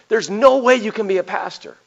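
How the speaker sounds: half-acted challenge from a tough guy; lightly voiced suspicious gym man. Ex-prisoner retelling his wife's doubts to the pastor, in a lightly voiced suspicious tone. Retold accusation continues.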